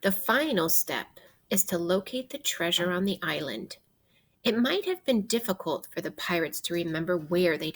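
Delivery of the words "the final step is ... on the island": ordinary delivery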